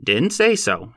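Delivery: a quick, curt correction; sharp reply. A narrator's reading. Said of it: "he replied sharply".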